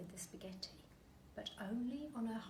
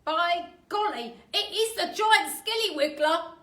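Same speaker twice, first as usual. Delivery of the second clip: higher, loud mock-alarmed old man; Mr Twit voice; 9 semitones higher, 16 dB louder than usual. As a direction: fake alarm to scare her.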